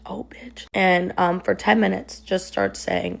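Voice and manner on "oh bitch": half-whispered, rising retold insult; hushed insult. Quoting boyfriend's insult to viewers, hushed. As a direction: whisper the swear as you quote it.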